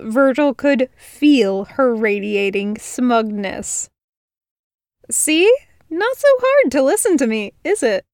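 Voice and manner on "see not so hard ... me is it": higher, quick teasing gloat; girl gloating after he finds the stitch. Acted. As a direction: needle him playfully.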